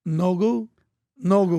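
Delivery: no go said with a light Miami accent; Miami saying. Shown as a demonstration. Showing how people in Miami say it, light Miami accent. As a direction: toss off no go the Miami way. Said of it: "In Miami, we say this".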